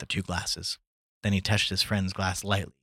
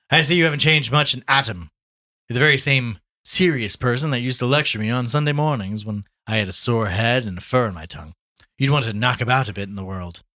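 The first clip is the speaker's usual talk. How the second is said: hearty, raised voice; breezy Gallagher, teasing; 4 semitones higher, 7 dB louder than usual. Acted. Gallagher teases Tommy for never having changed, breezy. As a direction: rib an old friend loudly.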